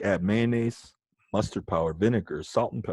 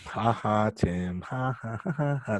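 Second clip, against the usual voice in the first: mock laughter. Teacher mocks lazy student Tim.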